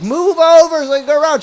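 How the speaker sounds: high, loud imitation of an impatient woman; mock demand. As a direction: mimic her ordering someone aside. Acting out her demand.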